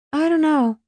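put-on high-pitched, noncommittal girl; shrugging. A narrator's reading. Alice answers her mother, shrugging it off. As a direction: toss it off with a shrug. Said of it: "Alice shrugged".

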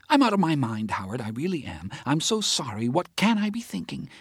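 a worked-up friend ranting about the case; Teresa's agitated voice. Acted. Teresa rants to Howard about the judge, agitated.

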